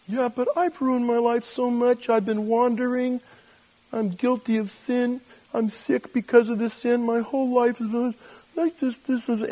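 high, whining stream of excuses; mock guilt-ridden plea, held high throughout; self-pitying protest. Sinner listing failures to God, self-pitying protest.

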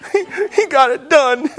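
high, choked-up mock weeping; teary. Preacher retelling a movie moment, high and teary. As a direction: squeeze the words out through tears. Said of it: "I cry in every movie".

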